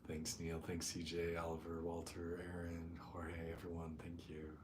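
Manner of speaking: soft, hushed meditation voice with long pauses; guided meditation. Real, not acted. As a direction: settle the group gently, speaking softly and unhurried. Leads a meditation.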